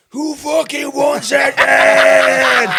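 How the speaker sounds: slow, loud, high-pitched shout; drunken challenge. Retold or imitated. Drunk dad challenging the home fans, slow loud shout. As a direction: bellow it slowly, picking a fight. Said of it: "Like that".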